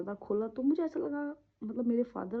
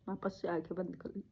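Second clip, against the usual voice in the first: usual speaking voice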